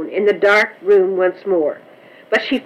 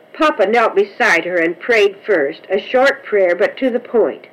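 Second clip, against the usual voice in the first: ordinary delivery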